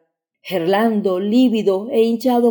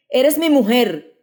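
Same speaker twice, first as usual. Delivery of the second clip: mild shout; 5 semitones higher, slower than usual. A narrator's reading. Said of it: "gritó entonces Gerlando".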